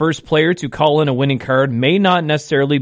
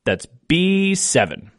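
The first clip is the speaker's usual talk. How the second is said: slow, deliberate number calling; bingo caller; slower than usual. Acted. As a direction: call each ball slowly with falling ends. Calls each bingo ball twice.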